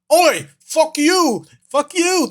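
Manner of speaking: high-pitched mock-British yell, drawn out; British guy. British guy answering the mimic in a high mock-British shout. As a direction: heckle in a hammed-up British accent. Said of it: "the British guy's all".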